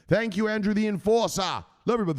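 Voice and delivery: high, punchy shout-out; hype announcer. Acted. Host signing off Andrew the Enforcer, hyped announcer. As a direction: call out thanks like a ring announcer.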